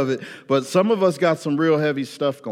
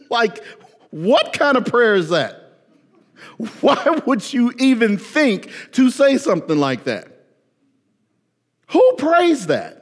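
high, loud disbelief, slowing down; who would even ask that; incredulous; 8 semitones higher, 4 dB louder than usual. Real, not acted. Preacher incredulous at the prayer.

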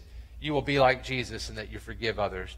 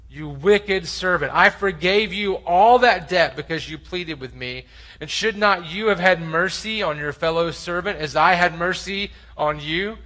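loud, higher, slowed scolding; angry rebuke; 5 semitones higher, 6 dB louder than usual. Acted. Master scolds the servant he forgave, loud and angry. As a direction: thunder the master's reproach.

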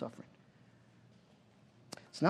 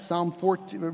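ordinary delivery

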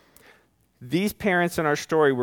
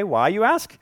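ordinary talk

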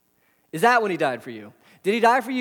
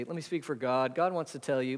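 softer, lower mock preacher voice; a light send-up of a cheesy preacher; 4 semitones lower, 7 dB quieter than usual. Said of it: "It feels cheesy when preachers say".

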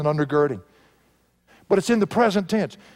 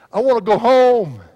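louder and higher, a yearning preached cry; impassioned cry; 6 semitones higher, 7 dB louder than usual. Retold or imitated. Preacher voicing a dying woman's longing, loud yearning cry. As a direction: ring out her longing for home.

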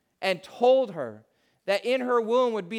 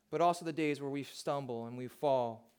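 ordinary talk, nothing marked

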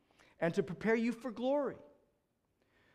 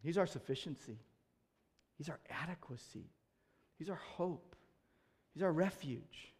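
hushed devotion; 5 semitones lower, 12 dB quieter than usual. Acted. Quiet devotional close.

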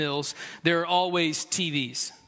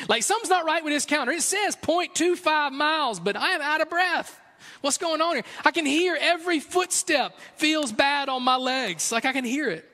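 mock out of breath; 8 semitones higher than usual.

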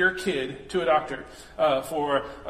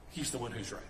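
much softer, lower, letting it sink in; soft emphatic repeat; 7 semitones lower, 13 dB quieter than usual. Real, not acted. Preacher repeating to the congregation, hushed. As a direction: drop to a near hush for weight.

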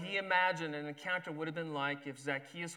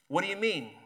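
louder, sharp and suspicious; hostile demand; 3 semitones higher, 6 dB louder than usual. Acted. Judah snaps at Zacchaeus, hostile. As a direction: push him away with the question. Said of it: "the tone sounded more like".

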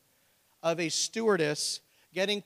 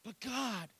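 whiny protest; 8 semitones higher, 7 dB quieter than usual. Pretend. Acted protest to God.

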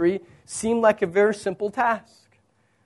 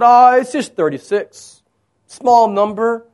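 dismissive; 6 semitones higher, 6 dB louder than usual. Acted. Imagined Joshua shrugging off losses.

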